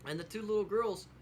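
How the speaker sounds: usual speaking voice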